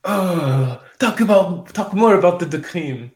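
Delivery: put-on swooning French accent. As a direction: beg in a hammed-up French accent. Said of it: "My family's French. I'm allowed to do that".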